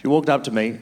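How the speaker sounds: ordinary delivery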